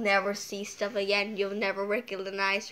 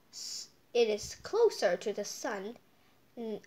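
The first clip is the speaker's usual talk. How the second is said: ordinary delivery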